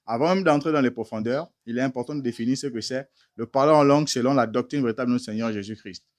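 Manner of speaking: normal talking voice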